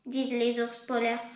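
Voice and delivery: ordinary delivery, nothing marked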